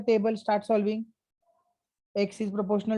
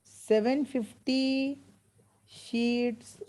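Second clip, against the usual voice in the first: slow dictation; 2 semitones higher, 3 dB quieter, much slower than usual. Shown as a demonstration. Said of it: "dictating the answer slowly".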